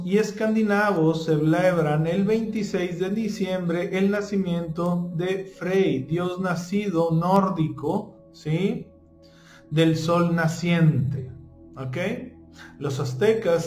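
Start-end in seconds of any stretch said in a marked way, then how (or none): none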